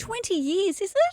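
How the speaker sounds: high, surprised "twenty years, is it?"; teasing high-pitched surprise; mock amazement. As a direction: play it up like it's astonishing news.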